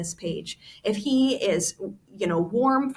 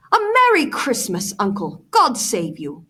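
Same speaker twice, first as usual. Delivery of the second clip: cheerful cry; 2 semitones higher, 7 dB louder than usual. Acted. Nephew bursting in on Scrooge, cheerful loud cry. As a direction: call it out with bright holiday cheer. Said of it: "cried a cheerful voice".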